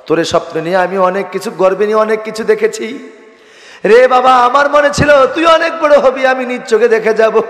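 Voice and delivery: high melodic lament in a dying mother's words; impassioned preaching. Acted. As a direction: build to a loud, high chanted cry.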